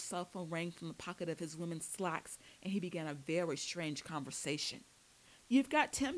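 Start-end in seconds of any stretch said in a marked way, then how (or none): 5.5-6.2 higher, louder burst of eagerness; excited exclamation. A narrator's reading. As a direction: exclaim it into the phone, delighted at the news. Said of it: "he exclaimed".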